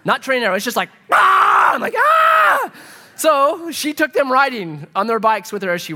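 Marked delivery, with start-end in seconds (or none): 1.1-1.7 high loud mock scream. Foster kids waking at six, mock screaming high and loud. 2.0-2.7 high loud mock scream. Foster kids waking at six, mock screaming high and loud.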